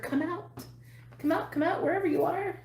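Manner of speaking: high playful hide-and-seek call; sing-song taunting. Retold or imitated. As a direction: tease them out singsong. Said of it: "I just heard".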